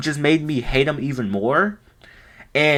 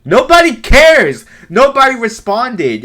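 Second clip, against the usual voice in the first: loud, high outburst of disbelief; exasperated shout; 8 semitones higher, 7 dB louder than usual. Real, not acted. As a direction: yell it at the screen, fed up. Ranting at a Discord user.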